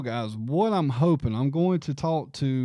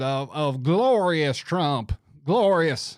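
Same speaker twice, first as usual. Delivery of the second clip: a lighter, slow, louder Southern drawl; Henry McMaster impression; 4 dB louder, slower than usual. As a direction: lean on glorious like a stump speech. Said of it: "I can't even do it".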